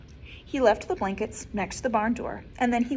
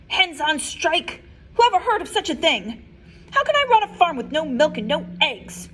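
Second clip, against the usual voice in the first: higher, louder indignant rant; outraged farmer; 5 semitones higher, 7 dB louder than usual. Acted. Farmer Brown fumes about the striking animals. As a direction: sputter in disbelief.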